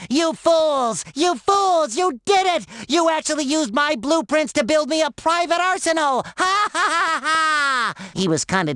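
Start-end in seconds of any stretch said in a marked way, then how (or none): 0.1-6.2 gloating villain outburst, pitched high; mock an evil mastermind's triumphant cry; Plankton. SpongeBob imitating Plankton seeing his blueprints built, gloating high cry. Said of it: "he kept saying". 6.4-8.1 high, drawn-out evil laugh; imitated villain cackle. SpongeBob imitating Plankton gloating over his arsenal, evil cackle. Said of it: "he kept saying".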